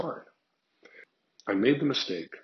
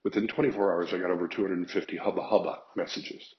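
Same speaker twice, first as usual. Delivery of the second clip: ordinary talk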